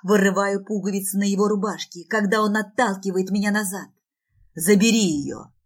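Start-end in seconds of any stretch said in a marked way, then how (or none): 4.5-5.5 loud, rasping order; harsh, husky raised voice; hoarse. Acted. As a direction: rasp out the command forcefully. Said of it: "хрипит мой муж".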